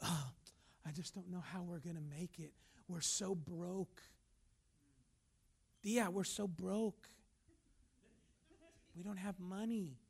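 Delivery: quiet, low, slowed-down lament; defeated fool. Acted. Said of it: "you'll always hear a fool".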